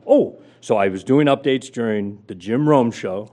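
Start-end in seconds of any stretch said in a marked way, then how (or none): 0.1-0.4 surprised. Retold or imitated. Told he starts Monday.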